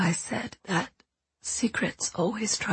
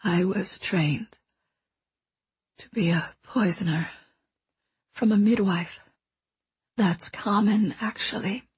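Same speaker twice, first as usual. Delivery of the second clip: weak dying whisper. Acted. Dying mentor confesses her past to the boy, weak whisper. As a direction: let each phrase cost her breath.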